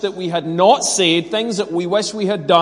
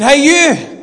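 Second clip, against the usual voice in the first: high, loud mock shout of an angry neighbour; acted-out indignant call; imitated shout; 8 semitones higher, 7 dB louder than usual. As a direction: holler it like someone calling you out. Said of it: "slightly elderly gentleman shouting at me".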